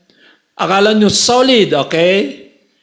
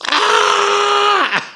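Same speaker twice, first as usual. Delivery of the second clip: mock delighted shout; 13 semitones higher than usual. Reacting to beautiful nurses.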